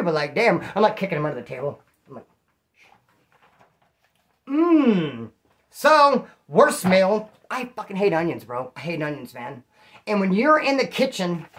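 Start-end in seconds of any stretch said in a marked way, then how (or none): 4.5-5.3 long falling "mmm"; imitated eating noise. Imitating a cellmate being kicked under the table, drawn-out mock moan. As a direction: mimic someone moaning over every bite. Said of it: "he'd be all".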